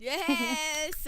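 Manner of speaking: high, loud, excited yes; delighted yes. Real, not acted. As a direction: cheer it out. Excited reply to chat.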